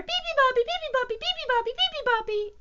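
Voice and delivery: very high, loud birdsong chatter; squeaky rapid-fire chirping; chirping little bird. A narrator's reading. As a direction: pipe it out like a tiny tireless bird. Imitates the bird's song.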